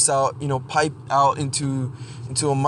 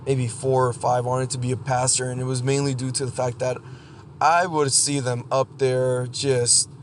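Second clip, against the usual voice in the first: ordinary talk, nothing marked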